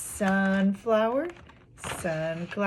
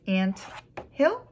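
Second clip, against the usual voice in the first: syllable by syllable. Shown as a demonstration. Teacher showing two syllables, broken apart. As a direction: break it apart, lifting "hill" sharply. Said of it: "those all had two syllables".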